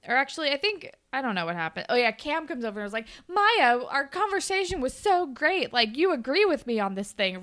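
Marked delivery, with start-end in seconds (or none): 3.3-7.4 higher, lilting mock enthusiasm; gushing. Cam runs up to Maya about their chat, gushing. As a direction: gush it, imitating an eager friend.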